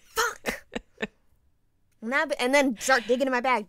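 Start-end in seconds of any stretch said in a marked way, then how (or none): 0.0-0.6 high startled swear; startled curse. Retold or imitated. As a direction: jolt awake and curse again. Waking up in ambulance again.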